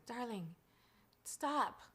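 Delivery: quiet imitation of Dad's English accent; hushed, lightly voiced English accent. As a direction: softly do an English father's accent. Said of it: "in his English accent".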